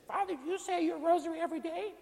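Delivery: high, pointed church-lady voice; pushy lady voice. Retold or imitated. Lady confronting the priest after Mass, high pushy voice. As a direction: jab the question at him like a nosy parishioner.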